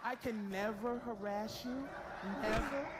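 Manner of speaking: ordinary talk, nothing marked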